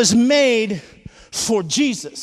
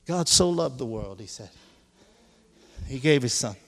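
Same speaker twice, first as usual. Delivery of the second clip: hushed preaching; 8 semitones lower, 7 dB quieter, faster than usual. Acted. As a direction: lower and much softer, drawing the congregation close. Drops to intimate low preaching.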